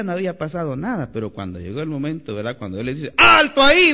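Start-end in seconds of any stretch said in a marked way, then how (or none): 3.2-3.9 loud, sharp halt order barked out; shouted command. Retold or imitated. Preacher voicing a leader commanding halt, loud. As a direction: stop the crowd dead with a shout.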